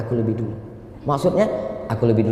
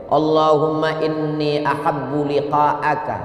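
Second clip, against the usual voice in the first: slow, raised prayer voice; drawn-out Arabic prayer recitation; recited supplication; 8 semitones higher, slower than usual. Acted. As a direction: recite it slowly and high, as a plea to God. Recites Abu Hurairah's Arabic supplication.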